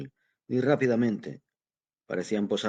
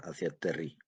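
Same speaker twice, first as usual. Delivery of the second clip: ordinary delivery, nothing marked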